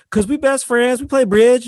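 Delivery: high-pitched mama bragging about bridge; old mom voice. Retold or imitated. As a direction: pipe up like a proud old mother. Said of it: "it's like".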